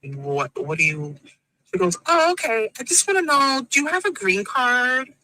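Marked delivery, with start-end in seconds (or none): none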